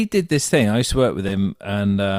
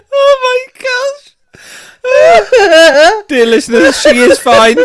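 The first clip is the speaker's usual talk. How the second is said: high, loud, laughing through the words; helpless laughter; 9 dB louder than usual. Real, not acted. Reassuring listeners while laughing helplessly. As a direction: gasp it out mid-laugh.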